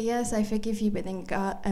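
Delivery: ordinary delivery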